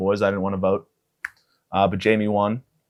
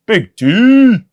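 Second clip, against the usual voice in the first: hype shout; 11 semitones higher, 7 dB louder than usual. Acted. Hyping up the name.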